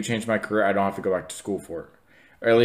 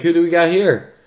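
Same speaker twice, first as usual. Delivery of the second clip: higher, louder imitation of a teacher's greeting; hearty teacher greeting; 8 semitones higher, 6 dB louder than usual. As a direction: imitate a teacher loudly welcoming visitors. Teacher greeting visitors at open house.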